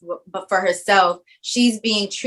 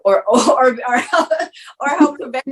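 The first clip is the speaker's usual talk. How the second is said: laughing; 5 semitones higher than usual. Real, not acted. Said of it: "laughs on these lines".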